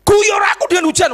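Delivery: high-pitched, bold prayer cry; challenging God. Retold or imitated. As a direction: re-enact a daring cry to God. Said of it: "Saya nantang langsung".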